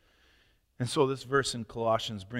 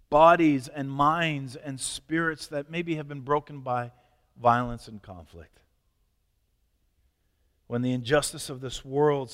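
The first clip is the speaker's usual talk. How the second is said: fervent, rising pastoral prayer voice; earnest prayer; 2 semitones higher, 7 dB louder than usual. Acted. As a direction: pray aloud for the congregation, heartfelt. Pastor leads closing prayer.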